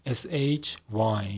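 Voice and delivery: letters spelled out slowly; slow spelling. Shown as a demonstration. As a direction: dictate each letter. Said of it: "spells the word".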